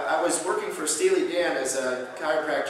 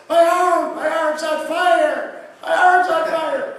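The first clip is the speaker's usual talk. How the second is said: high, loud panicked scream; imitated screaming; 9 dB louder, slower than usual. Patient screaming over the massager, high panicked scream. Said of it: "he started screaming".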